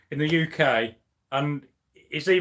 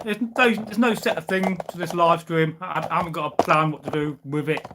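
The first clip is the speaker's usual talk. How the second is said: ordinary delivery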